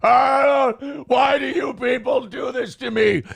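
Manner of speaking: mocking the crying streamer's wail; mock self-pitying wail. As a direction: wail it like a martyr. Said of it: "you're sitting there going".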